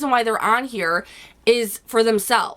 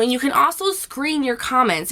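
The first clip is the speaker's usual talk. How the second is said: ordinary talk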